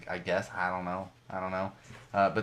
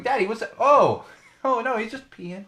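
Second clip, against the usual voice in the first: higher-pitched mock family exchange; acted toddler-and-parent exchange; 11 semitones higher, 4 dB louder than usual. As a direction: mimic a curious toddler, then a flustered dad. Voicing child and embarrassed parent exchange.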